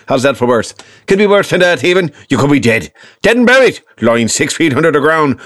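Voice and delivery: high, loud Northern Irish brogue; hammed-up Northern Irish granddad, pitched high; Northern Irish grandfather. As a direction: scold with escalating Northern Irish exasperation. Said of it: "from Northern Ireland, so his accent was a little different".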